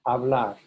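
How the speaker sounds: "Hablar" with weight on BLAR; stress on last syllable. Shown as a demonstration. Teacher repeats the correct infinitive, BLAR stressed in "Hablar". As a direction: lean on the ending of "Hablar". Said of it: "the A R ending is stressed".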